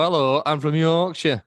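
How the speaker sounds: slow, broad Yorkshire demonstration; Yorkshire accent. Shown as a demonstration. Presenter showing co-host how it's done, Yorkshire accent. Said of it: "You gotta do a Yorkshire accent like that".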